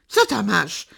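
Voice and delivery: loud alarmed shout; wild shout. Acted. Father shouts at his daughter in fear, loud. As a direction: bark it out in sudden panic. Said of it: "krzyknął tak dziko".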